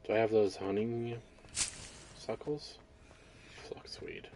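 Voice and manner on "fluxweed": whispering. Real, not acted. Whispered item name.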